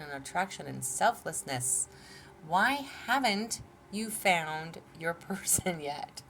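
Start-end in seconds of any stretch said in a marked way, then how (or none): 2.5-6.2 slow, giggling through the question; laughing question. Real, not acted. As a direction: let a laugh break the line. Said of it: "I'm totally laughing".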